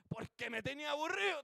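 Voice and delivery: high, loud fed-up judge; acted exasperation. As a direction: imitate a judge worn down by pestering. Juez injusto harto, imitado. (juez harto, imitado con voz alta)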